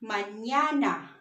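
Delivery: Mañana said slowly as "mah-NYAH-nah"; slow Mañana for learners to copy, ña clear; model pronunciation. Shown as a demonstration. Teacher asking learners to repeat, slow Mañana with clear ña. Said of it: "Can you say it?".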